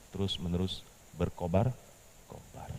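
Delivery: ordinary talk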